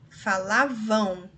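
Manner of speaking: Falavam said slowly with a final fall; slowed teacher's Falavam; slow syllable demo. Shown as a demonstration. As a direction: pull Falavam apart gently. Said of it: "veja só que eu tenho o som do U".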